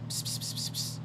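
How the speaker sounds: whispered psst to lure a cat; cat call psst. Real, not acted. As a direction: hiss psst softly at the pet. Calling the cat.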